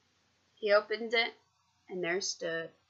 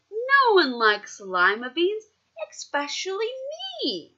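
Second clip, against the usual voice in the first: put-on stubborn child refusing; 11 semitones higher, 5 dB louder than usual. A narrator's reading. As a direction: sulk and refuse the beans. Camilla refuses beans.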